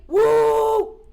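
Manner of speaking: high, loud "woo"; loud celebratory whoop; excited whoop. Real, not acted. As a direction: cheer with a whoop. Cheering at promised gifts.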